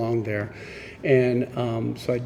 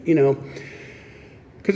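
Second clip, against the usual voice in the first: ordinary delivery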